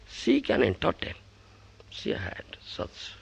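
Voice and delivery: plain reading voice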